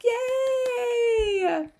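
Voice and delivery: high, loud, drawn-out cheer; excited cheer. Real, not acted. Streamer cheering a viewer who got the grocery list ready, excited cheer. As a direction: celebrate with a long, high-pitched whoop.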